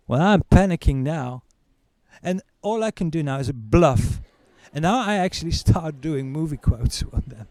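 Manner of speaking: ordinary delivery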